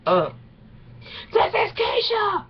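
high mock shout of the punchline; imitating him. Retelling what he said in the video, high mock shout. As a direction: ham up his line in a high yelling voice. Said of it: "he said".

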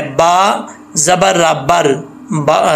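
बर slow with a thick रा; slow spelled बर; heavy ra. Shown as a demonstration. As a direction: spell out बर slowly. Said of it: "रा मोटा पढ़ा जाएगा".

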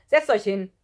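loud teacher's command; scolding order. A narrator's reading. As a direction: order the class down crossly. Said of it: "schimpfte Herr Rotbraun Bohnenhang".

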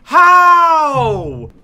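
high, loud cry of disbelief; anguished cry. Real, not acted. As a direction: wail at the failed jump. Failing the jump.

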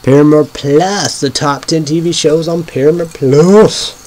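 hyped announcer. Real, not acted. Hyped intro of the ranking.

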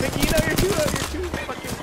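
urgent yell at a teammate; frantic yelling. Real, not acted. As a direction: shout her back, high and loud.

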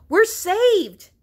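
high, loud whoop of relief; elated, ringing exclamation; jubilant cry. A narrator's reading. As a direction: shout it like a rescue has come. Rabbit found alive, hero cries out.

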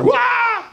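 high-pitched whooping "wah"; exuberant wild yell. Acted. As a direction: let out a carefree kid-like yell. Acting out a child's carefree yell.

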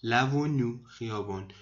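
usual speaking voice

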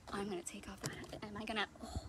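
whispered aside. Real, not acted. Librarian to kids, whispering aside.

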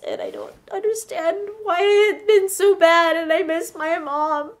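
hammed-up weepy voice; tearful. Girl alone in the dungeon missing mom, tearful.

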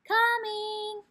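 high, loud shout out the window; girl shouting back to her mother from the treehouse. A narrator's reading. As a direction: yell it down to mom.

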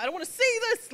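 nervous. Retold or imitated. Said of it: "'cause I'm nervous".

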